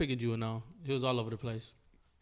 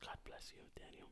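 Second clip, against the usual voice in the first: whispered; 25 dB quieter than usual. Real, not acted. Blessing after a sneeze.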